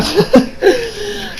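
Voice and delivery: brief high-pitched giggle; soft, squeaky burst of laughter. Real, not acted. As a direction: let a short high laugh slip out. Own laugh between remarks.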